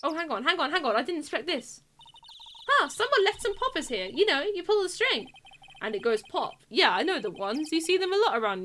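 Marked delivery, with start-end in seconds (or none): 2.7-5.3 lightly voiced chirpy sidekick, pitched up; higher, livelier figure voice; game character. Player voicing a game character who finds poppers, lightly pitched up.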